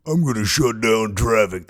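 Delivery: raspy mean threat; Mother Earth. Acted. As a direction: growl it out like a fed-up planet. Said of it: "That's her mean voice".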